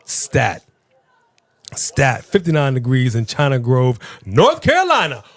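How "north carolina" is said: high, rising shout-out; excited, high-pitched state shout. Real, not acted. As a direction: call the state out like a cheer. Cheering a viewer's home state.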